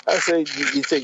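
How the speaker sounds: ordinary talk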